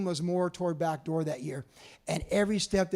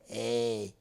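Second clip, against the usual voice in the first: menacing low voice; 7 semitones lower, slower than usual. Retold or imitated. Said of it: "he's like".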